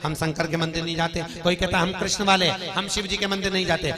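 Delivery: loud, ringing sermon delivery; full-force preaching. Acted. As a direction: preach it out to the whole crowd. Preacher at full force, voicing devotees and Shiva.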